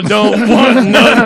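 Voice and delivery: slow, laughing song quote. Retold or imitated. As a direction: drag the lyric out while laughing. Quoting the rap, laughing.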